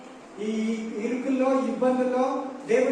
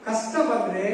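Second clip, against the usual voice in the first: ordinary talk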